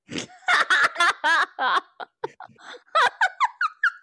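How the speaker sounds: loud, high cackling burst; real big laugh. Real, not acted. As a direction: crack up long and helplessly at your own joke. Cracks up at her movie joke.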